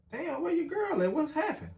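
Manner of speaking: high-pitched puzzled question, half worried; puzzled asking. Retold or imitated. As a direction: pitch it way up like you can't believe she's gone this long. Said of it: "We was like".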